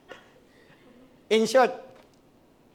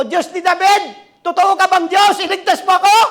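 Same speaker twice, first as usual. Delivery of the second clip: high, loud pleading cry; desperate cry to God; 10 semitones higher, 9 dB louder than usual. Retold or imitated. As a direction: beg God for rescue at full voice. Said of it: "Sabi ko". (sumisigaw na nagmamakaawa sa Diyos)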